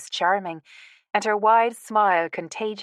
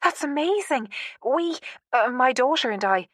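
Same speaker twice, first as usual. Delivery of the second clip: amazed; 6 semitones higher than usual. A narrator's reading. Stunned by meeting the grandson.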